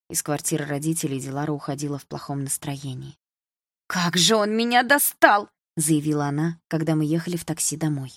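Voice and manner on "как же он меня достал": high, loud drawn-out exasperation; slow, emphatic complaint; fed up. A narrator's reading. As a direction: vent frustration about a father. Fed-up daughter complains afterwards.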